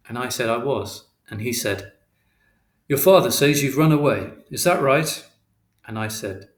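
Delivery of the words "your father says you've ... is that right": lightly voiced policeman, louder. Policeman questioning a runaway boy, firm and louder. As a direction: question him as an officer, slightly raised voice.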